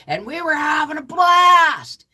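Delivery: high, loud, happy shout; bursting with delight; excited. Real, not acted. Excited recalling fun.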